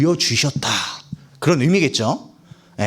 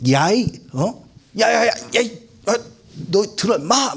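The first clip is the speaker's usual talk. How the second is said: hammed-up irritated street talk; angry Moses; 4 semitones higher than usual. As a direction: scold them in bursts, like a fed-up Moses. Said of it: "오늘 말로 하면".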